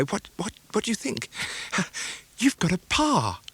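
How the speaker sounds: gasping out the news, high and excited; breathless. Acted. Nurse bursting out news to the boy, breathless. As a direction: rush it out short of breath, half laughing. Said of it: "speaking in a breathless sort of way".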